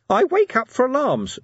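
very high, loud shriek; shrieking imp. Acted. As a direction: shriek it out of the box. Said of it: "the imp shrieked".

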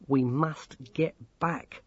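slow, firm insistence; measured and resolute. A narrator's reading. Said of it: "said Dorcas firmly".

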